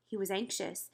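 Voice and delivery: usual speaking voice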